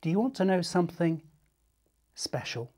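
ordinary delivery, nothing marked

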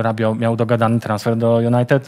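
usual speaking voice

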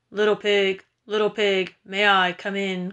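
wolf voice calling out; big bad wolf. A narrator's reading. Wolf calls outside the stick house, menacing wolf voice. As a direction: play the wolf, asking to be let in. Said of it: "called the big bad wolf".

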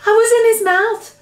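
high, loud exclamation; distressed little Sophie. A narrator's reading. Sophie tells BFG of her ordeal, exclaiming. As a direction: blurt it out in a high voice.

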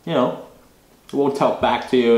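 chewing between words; talking with food in mouth; mouth full of sandwich. Real, not acted. Seen 2: Bites sandwich at -2s, then talks with cheeks moving, sandwich held.

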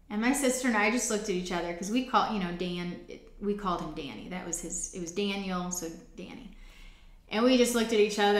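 usual speaking voice

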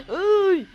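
high piercing shriek of fright; scared scream. Real, not acted. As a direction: scream in real terror. Another jump scare.

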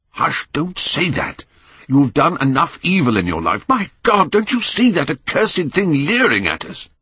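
high-pitched, urgent horror; agitated, high-voiced dread; horrified alarm. A narrator's reading. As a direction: plead in panic, pitch leaping at the oath. Horrified at the cursed portrait.

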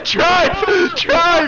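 high, loud frantic shouts; panicking gamer. Real, not acted. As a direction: scream at them to get away. Bear attacking, begging to escape.

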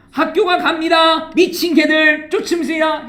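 high, loud monotone recital; mock literal translation. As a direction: read it out high and stiff, like a silly literal translation. Mock reading of awkward literal translation.

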